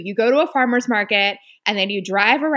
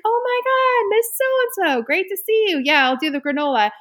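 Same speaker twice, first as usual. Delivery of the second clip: high, bright mock-polite greeting; fake friendly greeting; 10 semitones higher than usual. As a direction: gush sweetly at an old acquaintance. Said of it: "And I'm like".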